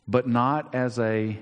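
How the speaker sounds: ordinary talk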